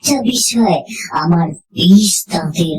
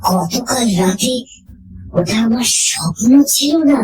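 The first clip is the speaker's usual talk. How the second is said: higher, fast, breathless question; shocked disbelief; 6 semitones higher than usual. A narrator's reading. Narrator realizing last night's visitor was no dream, shocked. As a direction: blurt it out as the truth dawns on you.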